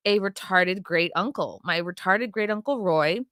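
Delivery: ordinary delivery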